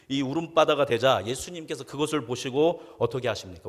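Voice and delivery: ordinary delivery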